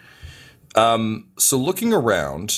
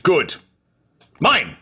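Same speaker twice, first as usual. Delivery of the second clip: high, sudden character exclamation; raised, theatrical grab; gleeful snatch; 9 semitones higher, 4 dB louder than usual. Acted. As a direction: claim it abruptly, cutting off the lecture. Phalox snatches the fuel cell.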